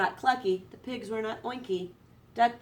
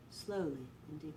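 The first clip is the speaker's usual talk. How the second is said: whispered breath; 5 semitones lower, 12 dB quieter than usual. A narrator's reading. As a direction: breathe the words out softly. Duck breathing out.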